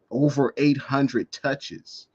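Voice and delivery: usual speaking voice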